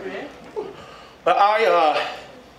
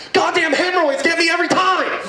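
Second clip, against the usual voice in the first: mock exasperated outburst; 6 semitones higher than usual. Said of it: "And I'm like".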